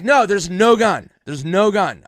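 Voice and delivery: loud, high, slowing insistence; retold emphatic denial. Joker calming panicked friends, loud emphatic denial. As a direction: stretch out a forceful, acted correction.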